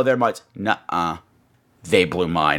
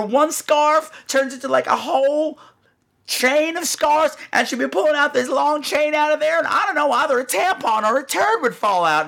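friend; 8 semitones higher than usual. Retold or imitated. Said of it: "Bradford's like".

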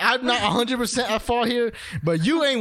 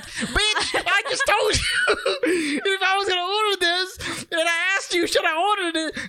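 laughing, higher exasperated comeback; mock exasperated retort; 8 semitones higher than usual. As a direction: laugh through an indignant retort. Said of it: "I'm like".

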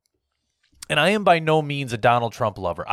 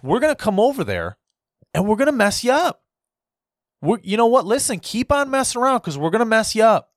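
tough threat; 6 semitones higher than usual. Acted. Voicing America warning the Taliban, hammed-up threat. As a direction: talk tough like you're warning them off. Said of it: "we should be looking at them and saying".

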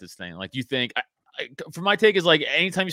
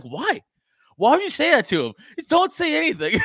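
exasperated mock plea; 12 semitones higher, 4 dB louder than usual. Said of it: "I'm like".

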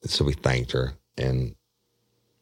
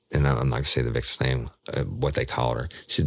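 usual speaking voice

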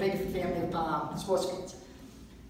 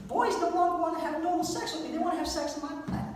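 put-on much higher girlish confiding voice; female friend; 10 semitones higher than usual. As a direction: play the girl, high and matter-of-fact.

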